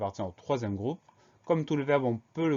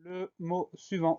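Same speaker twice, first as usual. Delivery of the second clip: ordinary talk, nothing marked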